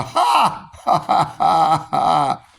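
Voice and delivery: forced hearty ha-ha laughter; belly-laugh the syllables out; staged laughter exercise; laughing yoga. Shown as a demonstration. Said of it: "I may start my laughing yoga".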